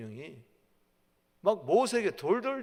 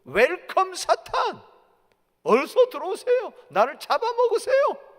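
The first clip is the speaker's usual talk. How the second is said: high, loud theatrical invitation; mock welcome; 13 semitones higher, 12 dB louder than usual. Foolish believer invites Satan in, mock welcoming shout. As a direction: call out an exaggerated hearty welcome.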